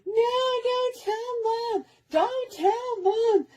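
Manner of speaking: put-on high, slow begging kid voice, drawn out; pleading little brother. Bleeding brother begging his sister not to tell, high and slow.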